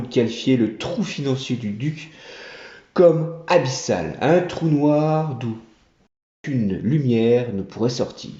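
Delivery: ordinary delivery, nothing marked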